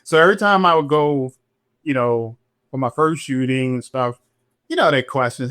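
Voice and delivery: usual speaking voice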